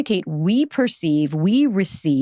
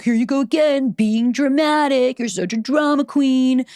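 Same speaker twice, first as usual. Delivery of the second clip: higher, drawn-out taunt at oneself; mock self-scolding; 5 semitones higher, slower than usual. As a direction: act out a belittling inner voice, dragging the words. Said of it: "I could have very easily gaslit myself".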